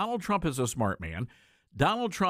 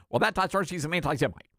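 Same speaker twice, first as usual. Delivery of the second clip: rapid-fire pundit impression; Ben Shapiro; faster than usual. Host mimicking a pundit's accusation, talking really fast. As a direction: rattle it off in a fast rush. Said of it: "He talks really fast. That's, that's my Ben Shapiro".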